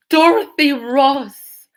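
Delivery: name cried out high, loud and slow; shocked cry. Real, not acted. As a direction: call the name in stunned disbelief. Shocked at a big donation.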